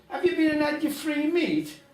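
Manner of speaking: high, loud coaxing voice; sly old lady. Acted. Old lady luring the ne'er-do-well, high and sly. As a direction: dangle the bait up high.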